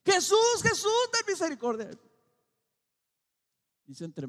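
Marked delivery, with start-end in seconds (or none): 0.0-1.9 clamor muy agudo y fuerte; grito desesperado. Acted. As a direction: grita como un ciego que suplica. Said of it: "más fuerte gritaban". (los ciegos le gritan a Jesús, suplicando a gritos)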